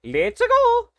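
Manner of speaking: very high, loud cartoon plumber voice; gleeful hero ready to go, way up high; Mario voice. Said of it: "Mario voice impression says".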